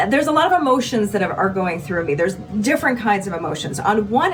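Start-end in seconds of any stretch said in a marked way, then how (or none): none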